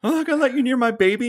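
high, fast, shrill-pitched imitation of a panicked mom; hammed-up frantic heroine; mock hysterical mother. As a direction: mimic her overwrought accusation. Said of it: "she's like".

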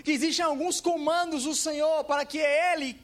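emphatic pulpit declaration; forceful preaching. Real, not acted. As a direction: proclaim it firmly to the congregation.

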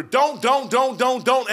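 loud, high, hammered repetition; nagging prohibition. Acted. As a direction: rap out each one like a nagging parent. Mimics parents forbidding over and over.

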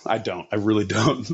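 usual speaking voice

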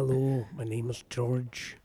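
a gentle, hushed Scottish voice, taking the piss; soft Scottish accent. Shown as a demonstration. Mocking a friend's accent, a soft breathy Scottish accent. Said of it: "A really soft Scotsman".

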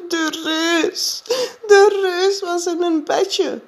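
put-on high, tearful, slow child's voice; frightened crying child. A narrator's reading. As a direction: sob out the nightmare to mother. Jip frightened after nightmare. (Jip huilt bang na een nachtmerrie, hoog en betraand)

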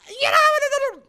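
very high, loud screech of babble; piercing mock scream; mimicked screaming. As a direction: imitate her shrieking over everyone. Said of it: "She's fucking".

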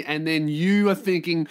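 usual speaking voice

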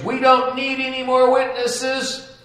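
loud, high screaming high priest; a furious judge screaming his head off. Acted. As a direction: scream it in a fit of rage. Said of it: "he screams".

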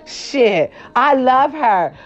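usual speaking voice

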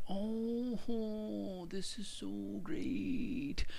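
high, slow sarcastic gush. Retold or imitated. To the email, fake delight, high and slow. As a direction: drag it out, high and airy, dripping sarcasm. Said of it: "And I was like".

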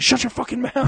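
high, loud mock outburst; retold angry snap. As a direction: act out shutting a doubter up. Retold angry comeback to friend.

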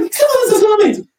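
high, fast, disbelieving cry; incredulous outburst. Retold or imitated. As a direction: shriek in disbelief at him. Retelling his baffled reply.

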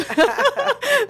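sudden high, loud burst of laughter; high excited laugh. Real, not acted. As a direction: laugh out in overwhelmed delight. Overwhelmed host bursts out laughing.

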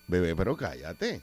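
gently hushing. Shown as a demonstration. Sweet version of shut up.

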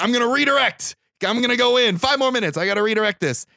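imitating Denzel's lawyer, hammed-up and keen; eager mock lawyer. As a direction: hype yourself up to jump back in.

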